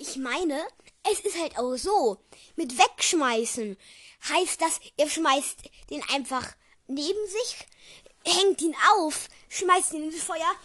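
animated protest at full volume; loud, animated argument. Real, not acted. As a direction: argue it loudly, pitch pushed up. Co-reader argues over throwing the cloak.

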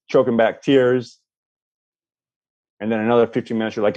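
normal talking voice

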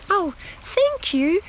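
very high squeaky thanks; squeaked. A narrator's reading. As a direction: squeak it like a tiny grateful rabbit. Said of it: "squeaked Lettice".

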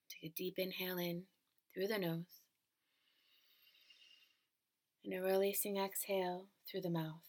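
calm, even relaxation-script delivery; guided meditation. Acted. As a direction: guide the listener gently into stillness.

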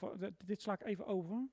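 ordinary talk, nothing marked

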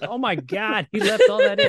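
squeaky high laughing disbelief. Retold or imitated. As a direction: laugh through it, mock shocked. Retelling reaction to edited episode.

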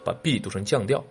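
normal talking voice

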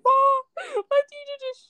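high, slow, whiny imitation of her upset self; mock whining to mom. Teen complaining to mom about her teacher, whiny and high. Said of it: "I came home, I was like".

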